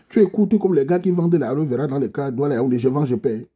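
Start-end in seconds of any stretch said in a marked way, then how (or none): none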